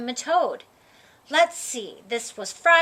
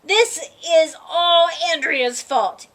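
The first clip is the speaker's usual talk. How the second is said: high, loud angry yell; 4 semitones higher, 5 dB louder than usual. Acted. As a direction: shout the blame at her. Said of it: "A J started yelling again".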